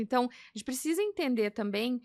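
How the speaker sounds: normal talking voice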